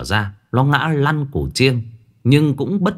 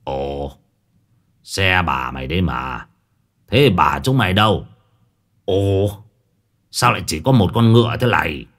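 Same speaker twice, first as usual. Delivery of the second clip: put-on puzzled old master; 4 semitones lower than usual.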